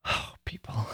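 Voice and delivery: breathy whispered aside; whispered, fed-up plea; whispered exasperation. Real, not acted. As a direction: sigh it out under your breath.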